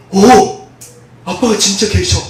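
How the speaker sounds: put-on loud, delighted child's cry; a child amazed his dad is real; joyful surprise. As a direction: burst out with glee. Child receives gift, overjoyed.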